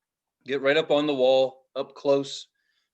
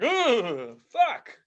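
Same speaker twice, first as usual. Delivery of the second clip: creeped out; 7 semitones higher than usual. Acted. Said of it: "I creep myself out thinking about it".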